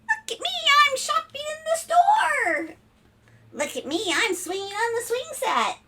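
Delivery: very high, gleeful spider voice; thrilled little spider. Acted. Spider riding on the family car, thrilled showing off. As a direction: show off with squealing glee.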